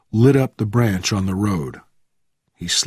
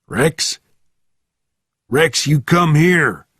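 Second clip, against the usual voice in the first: raised, loud call to a dog; 6 semitones higher, 5 dB louder, slower than usual. A narrator's reading. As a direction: call out higher and louder, stretching it. Driver calls his missing dog.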